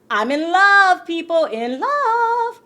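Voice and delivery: high, loud swooning cry; gushing. Real, not acted. Vlogger gushing to viewers about her idol, high loud swoon. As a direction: swoon out loud, stretching the end.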